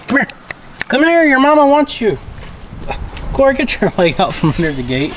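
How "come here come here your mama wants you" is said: high sing-song coaxing; puppy-coaxing voice. Real, not acted. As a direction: call the puppy sweetly, higher.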